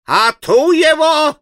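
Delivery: put-on a high, slow wail from the old man; shrill panicked cry of an old man. A narrator's reading. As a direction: howl it out in alarm. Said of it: "завопил старик".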